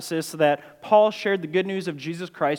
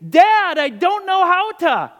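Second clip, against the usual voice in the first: put-on much higher, loud child's whining blast; child's frustrated yell; 13 semitones higher, 7 dB louder, slower than usual. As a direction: yell it like a frustrated four-year-old. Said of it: "a verbal blast of saying".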